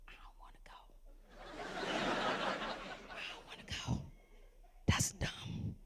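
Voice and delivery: hushed unvoiced whisper; breathless secretive whisper. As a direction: whisper it like you don't want the others to hear. Reluctant friend quoted in car.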